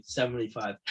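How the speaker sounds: ordinary delivery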